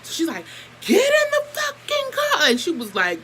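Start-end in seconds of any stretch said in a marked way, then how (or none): 0.8-2.5 high, slow, outraged command; mock furious yell from an upset grown-up; imitating an upset adult. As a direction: bark the order like a fed-up aunt. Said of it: "first time Bougie ever cursed in front of us".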